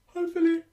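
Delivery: a big audible yawn; demonstration yawn. Shown as a demonstration. Flute teacher yawning to show her class. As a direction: yawn out loud for the students. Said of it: "you'll listen to me yawning".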